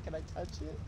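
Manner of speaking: very soft, hushed asking; mock-starstruck request, barely daring to ask; low-key reverent hush; timid awe. Starstruck, shyly asking permission.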